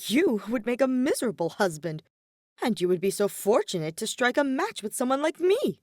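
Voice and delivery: indignant nobleman. A narrator's reading. Ferdinand indignantly lecturing Hubert on marriage, affronted. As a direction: puff up with injured pride.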